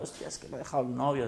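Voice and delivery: quick, whiny mock lament of a dumped girl; half-mocking tearful complaint; imitating a weepy girl. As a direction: play the heartbroken patient, lightly hammed. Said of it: "una chica llorando".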